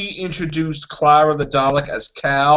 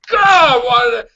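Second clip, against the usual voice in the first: high, loud exasperated outburst; pitched-up exasperated wail; mock exasperation; 6 semitones higher, 5 dB louder than usual. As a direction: cry out as if regretting it all. Acting out Moffat's exasperated reaction.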